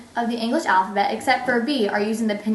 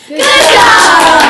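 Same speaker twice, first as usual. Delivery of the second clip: loud praise; 6 semitones higher, 14 dB louder than usual. Real, not acted. Teacher praising the class.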